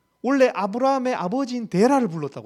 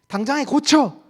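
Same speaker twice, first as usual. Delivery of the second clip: raised, higher scolding yell; 호통치는 고함; 4 semitones higher, 5 dB louder than usual. Acted. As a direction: yell an order to shape up at once. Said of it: "이렇게 소리 지르는 게 아니에요". (다그치며 소리 지르는 말투)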